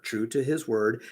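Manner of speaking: plain reading voice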